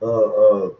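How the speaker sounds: usual speaking voice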